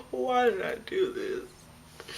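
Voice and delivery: high, drawn-out mock wail; freaking out. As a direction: wail it slowly in exaggerated panic. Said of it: "freaking out".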